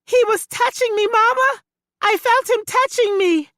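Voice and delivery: put-on high, loud terrified girl; screaming.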